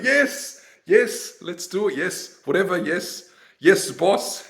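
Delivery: high, laughing yes-man voice; hammed-up eager yes-man; mock submissive man. Mocking submissive men agreeing to everything, high.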